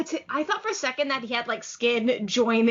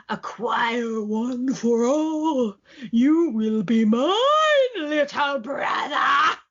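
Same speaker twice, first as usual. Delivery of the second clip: creepy inner voice; 4 dB louder, much slower than usual. Retold or imitated. Evil voice speaking in his head.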